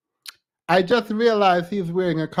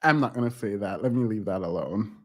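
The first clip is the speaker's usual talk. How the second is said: quiet aside; 10 semitones lower, 7 dB quieter, faster than usual. Real, not acted. Muttering self-censoring aside.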